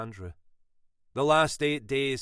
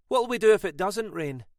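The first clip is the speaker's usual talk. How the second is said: higher, louder comeback with weight on do; wry retort; 9 semitones higher, 7 dB louder than usual. A narrator's reading. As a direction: toss the question back wryly. Mackenzie turns the question back.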